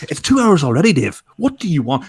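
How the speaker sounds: really fed up, arguing loudly; exasperated. Real, not acted. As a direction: push back at your friend, voice jumping up. Exasperated defence of the film.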